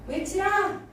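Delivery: high, ringing order to the people; rallying cry, pitched well up; acted command shout. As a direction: call out like a leader giving the signal. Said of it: "하고 크게 말했을 때".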